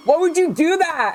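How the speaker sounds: high, hammed-up outraged brother; mock the brother's indignant yell; imitating angry brother. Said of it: "He was like".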